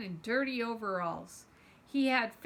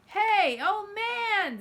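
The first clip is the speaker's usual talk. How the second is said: loud, much higher holler; excited holler; 10 semitones higher, 7 dB louder, much slower than usual. Acted. Zenny hollering happily to the old man who saved them. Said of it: "Zenny hollered".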